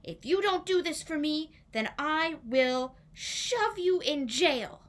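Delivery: hammed-up royal menace; mock threat. King threatening Marian.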